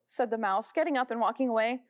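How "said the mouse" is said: plain reading voice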